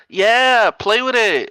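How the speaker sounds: high, loud taunt; mock loud street woman. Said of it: "And she goes".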